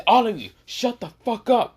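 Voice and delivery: high, loud barked order; a thug shouting at the class; angry shout. Acted. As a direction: yell it to silence the room. Gang member silences the room.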